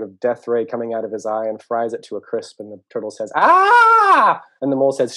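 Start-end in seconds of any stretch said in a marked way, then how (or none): 3.4-4.4 long, high, very loud scream; turtle's scream. Acted. Turtle sees the flower fried, long scream. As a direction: scream out on one held high note. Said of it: "the turtle is still screaming".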